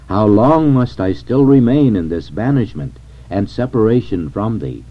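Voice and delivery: usual speaking voice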